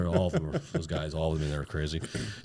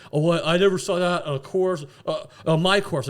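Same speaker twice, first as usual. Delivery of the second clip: mock flustered, higher and louder; flustered stuttering; 7 semitones higher, 5 dB louder than usual. Cornered cart driver makes excuses to angry golfers, mock flustered. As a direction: imitate a cornered man fumbling for words. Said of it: "I don't know, stuttering".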